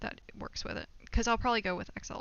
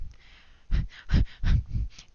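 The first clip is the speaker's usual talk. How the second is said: loud, airy laughing fit; embarrassed laugh; 8 dB louder than usual. Real, not acted. Streamer about to admit something awkward, embarrassed laugh. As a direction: laugh before an awkward confession.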